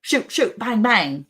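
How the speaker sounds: high, punchy cartoon Texan accent; hammed-up Texas twang, whooped out; mock Texan. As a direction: play a trigger-happy Texan. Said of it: "That's how they would say it".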